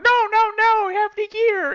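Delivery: high-pitched, loud mock objection; mock insistent protest. Guildmates overruling the guy who just joined, mock insistent. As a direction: imitate officers overruling someone, voice shooting up. Retells officers' protest to newcomer.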